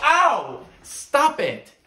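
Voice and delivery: high, loud pained protest; just got slapped. Acted. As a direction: yelp then yell to stop. Being slapped repeatedly.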